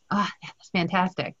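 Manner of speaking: normal talking voice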